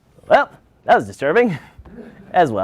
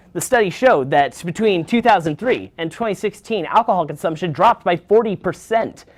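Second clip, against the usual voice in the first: ordinary talk, nothing marked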